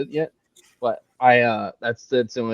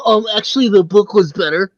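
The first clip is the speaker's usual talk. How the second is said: high, loud nasal-free pedant voice, pitched way up; know-it-all movie snob; 10 semitones higher, 9 dB louder than usual. Retold or imitated. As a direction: play the smug "actually" guy. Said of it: "one of those people who'll be like".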